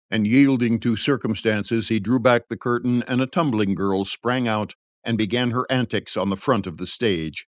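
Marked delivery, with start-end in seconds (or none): none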